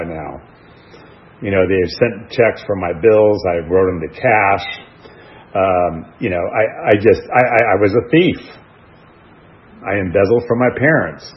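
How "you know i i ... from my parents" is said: ordinary delivery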